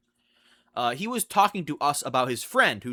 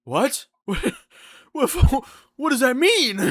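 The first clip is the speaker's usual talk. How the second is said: much higher, louder shocked what; 10 semitones higher, 5 dB louder than usual. Retold or imitated. Speaker stunned by coworker's confession, high and loud. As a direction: blurt it out in disbelief.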